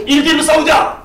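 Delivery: loud, high taunting shout; booming giant's challenge; Goliath's challenge. Acted.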